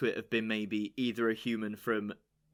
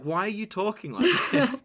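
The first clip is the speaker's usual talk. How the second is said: high, loud, teasing disbelief; exasperated mock question; 11 semitones higher, 6 dB louder than usual. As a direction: ham up the bafflement at the accent. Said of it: "I'm like".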